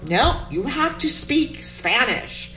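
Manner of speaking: lightly voiced strict father at the dinner table; mildly imitated father insisting on Spanish; stern father. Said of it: "my father would say".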